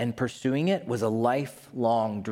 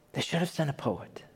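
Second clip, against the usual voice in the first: softer, fading echo of awe; soft wonder; 6 dB quieter than usual. Retold or imitated. Her line repeated softly, a quieter awed echo. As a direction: say it under the breath. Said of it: "she says".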